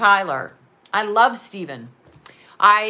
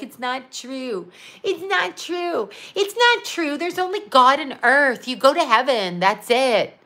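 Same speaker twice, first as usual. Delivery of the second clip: mocking the doubters; 3 semitones higher than usual. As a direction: imitate scoffers, pitch climbing with each denial. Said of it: "People mock and they laugh".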